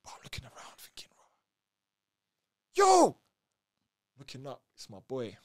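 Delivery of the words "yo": loud, high yell upward; calling back up to his boy; shout. Retold or imitated. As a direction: call up to a friend.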